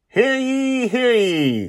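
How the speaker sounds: loud high crier's call, hands cupped. Acted. Father calling everyone on deck, loud crier's call. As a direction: bellow it across the deck like a town crier. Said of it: "Justin calls again".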